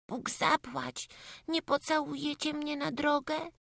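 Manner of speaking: put-on small boy pleading for a goodbye kiss; little boy's farewell. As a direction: ask shyly as a small boy would. Voices boy saying goodbye.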